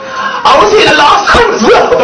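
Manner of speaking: high-pitched, hammed-up wail through laughter; mock woman's cry. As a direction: imitate her, cracking up as you go. Acts out woman's quote while laughing.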